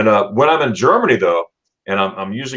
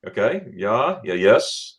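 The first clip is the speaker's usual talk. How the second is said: usual speaking voice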